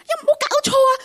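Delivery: high, loud mock scolding in Cantonese. Husband on the phone scolding his wife, retold as a high loud mock scold. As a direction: bark it out like an outraged husband. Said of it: "the husband was scolding her so loud".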